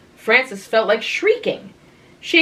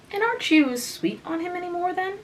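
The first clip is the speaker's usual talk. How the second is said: teasing; 11 semitones higher, 4 dB quieter than usual. A narrator's reading. Teasing sister probes.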